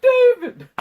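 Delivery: very high, loud squeal of the name; hammed-up delighted yelp; gleeful mock cry. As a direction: shriek it like an adoring fan. Acting character delighted by David.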